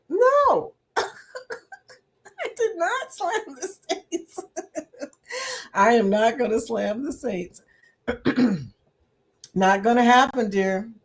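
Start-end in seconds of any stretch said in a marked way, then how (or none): 0.1-5.7 high squeal, then slow words through laughter; laughing denial. Real, not acted. Answering Denise's teasing, laughing denial. Denying she slammed the Saints.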